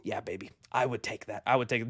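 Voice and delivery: low soft aside; low, soft purr. Real, not acted. Drooling over casting pick.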